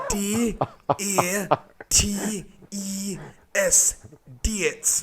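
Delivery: put-on spelled out very slowly, high, in Sunderland accent; strong Sunderland accent. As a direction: imitate a Sunderland man spelling letter by letter. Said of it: "he's got a strong accent".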